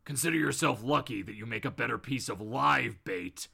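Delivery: low, restrained menace; deep voice, deliver a parting warning; Batman. Acted. The vigilante letting Bob go with a low warning. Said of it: "rises back up to his full height and goes".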